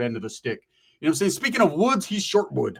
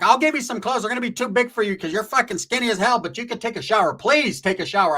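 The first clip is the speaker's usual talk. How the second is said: high, pleading mock mom voice; lightly voiced mom begging; voicing Roach's mom; 6 semitones higher, 3 dB louder than usual. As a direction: pitch it up like a worried mom. Mom voiced in retold story.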